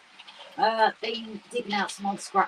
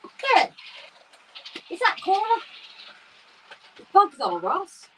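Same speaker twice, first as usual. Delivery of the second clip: surprised; 10 semitones higher than usual. Real, not acted. Spotting a bug on stream.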